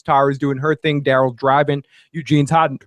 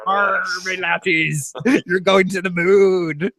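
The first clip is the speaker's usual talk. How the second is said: slow, higher hammy pirate; Jackie Gleason; 4 semitones higher, slower than usual. Acted. Host mocking Phil's impression, drawn-out pirate voice. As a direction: stretch every word like a stage pirate. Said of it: "impression is a fucking pirate".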